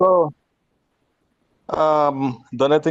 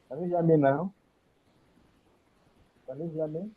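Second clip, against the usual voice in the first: faint mic; 4 semitones higher, 7 dB quieter, faster than usual. Real, not acted. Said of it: "Volume, we can't hear you".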